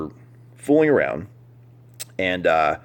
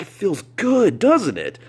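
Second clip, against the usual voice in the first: high teasing tone; mock cheery partner; 12 semitones higher, 4 dB louder than usual. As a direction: play her as delighted with herself. She claims punching feels good.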